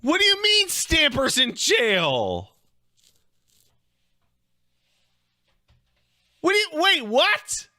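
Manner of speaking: loud, high disbelief; stunned disbelief; shocked. Real, not acted. Shocked by news.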